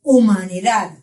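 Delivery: "Humanidad" with the last D barely sounded; weak final D. Shown as a demonstration. Teacher giving an example, final D hardly noticeable. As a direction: let the final D of "Humanidad" almost vanish. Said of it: "ela é bem fraca".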